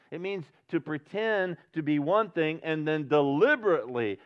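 loud, high, surging preacher's voice; impassioned preaching. Real, not acted. Pastor preaching fervently to the church, loud and high. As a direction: preach it out with full fervor.

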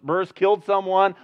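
ordinary talk, nothing marked

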